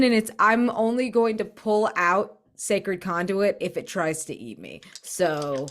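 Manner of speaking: ordinary delivery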